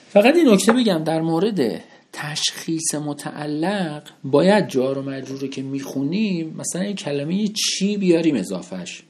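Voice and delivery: usual speaking voice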